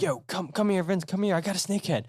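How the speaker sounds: eager call to a fishing buddy across the water; excited calling out. Retold or imitated. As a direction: shout to Vince that you've hooked one. Calling friend over to the catch.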